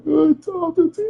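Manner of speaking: high wailing imitation of a crying woman; mock crying. Imitating the crying woman handing over the phone, mock wail. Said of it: "when she up there crying".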